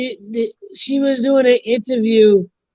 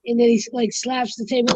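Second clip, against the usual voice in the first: normal talking voice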